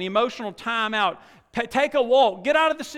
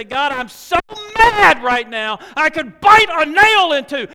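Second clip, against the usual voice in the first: loud, angry venting to God; mock furious prayer; 7 semitones higher, 12 dB louder, slower than usual. Preacher voicing a congregant's angry prayer, loud. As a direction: let the frustration burst out, slowed on mad. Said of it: "you say".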